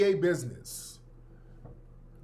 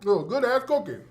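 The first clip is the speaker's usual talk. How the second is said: ordinary talk, nothing marked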